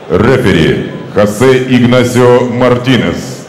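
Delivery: booming ring announcer, names drawn out; ringmaster. Acted. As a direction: announce it like a title fight. Boxing ring announcer introducing fighters.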